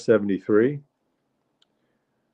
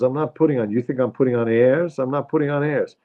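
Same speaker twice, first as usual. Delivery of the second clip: higher-pitched, defensive wife impression; protesting mother; 6 semitones higher than usual. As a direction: protest the accusation as the New York mother.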